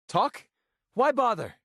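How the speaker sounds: very high, indignant retort; exasperated scorn. Acted. Refusing to talk to a partner, exasperated and high. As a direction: throw it back at them, voice leaping up.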